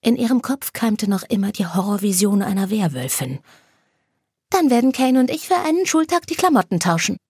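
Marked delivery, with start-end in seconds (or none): 4.5-7.2 higher, playful voice; a hint of a mischievous friend; Anna suggesting. Anna proposing a clothes swap, lightly playful. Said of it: "schlug Anna vor".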